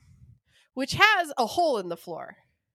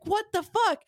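startled; 8 semitones higher than usual. Retold or imitated. Wife rushes in alarmed.

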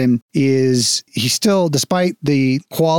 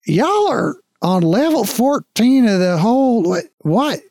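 baffled guard; 8 semitones higher than usual. Acted.

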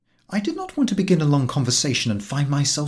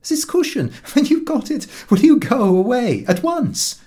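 put-on shop girl pleading; 10 semitones higher, 4 dB louder than usual. A narrator's reading. Giggling shop girl repeats question.

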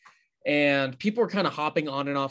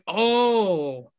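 surprised oh; 6 semitones higher, 4 dB louder than usual. Retold or imitated. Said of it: "I was like".

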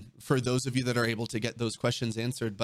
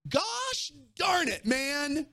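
mock exasperated shout; 13 semitones higher, much slower than usual. Mock exasperation at great question.

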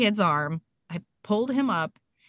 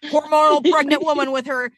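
high, loud, sheepish cover-up; hammed-up embarrassed cheer; mock-breezy public excuse; 5 semitones higher, 5 dB louder than usual. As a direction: brush off the onlookers, pitched up. Greets staring onlookers while dragging son.

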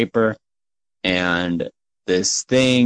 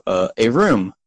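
ordinary talk, nothing marked

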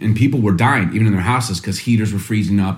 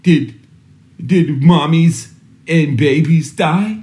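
put-on a little boy's voice, higher and slow; son's worried voice; 5 semitones higher, much slower than usual. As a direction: mildly voice the scared son asking about mommies. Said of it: "he has to go".

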